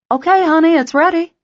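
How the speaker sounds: high, loud call from another room. Acted. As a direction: call across the house to him. Calling from the kitchen.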